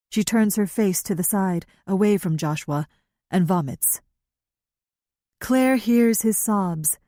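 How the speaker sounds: plain reading voice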